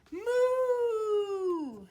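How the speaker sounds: high, loud mooing; cow moo. Acted. Teacher voicing the cows for a child, loud moo. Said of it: "They say".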